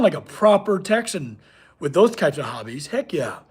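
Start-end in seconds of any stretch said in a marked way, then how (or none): 2.9-3.4 whispered heck yeah under the breath; soft private whisper. Real, not acted. Echoing the Texan comment.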